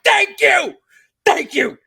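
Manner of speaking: hammed-up relief at finally being backed up; vindicated thanks. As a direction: crow like you've been proven right. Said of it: "And I'm like".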